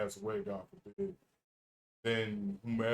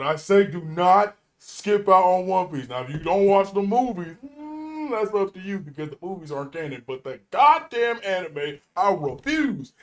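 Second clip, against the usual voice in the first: passionate loud rant; 6 semitones higher, 10 dB louder than usual. Real, not acted. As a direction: preach it with real heat. Passionate One Piece recommendation.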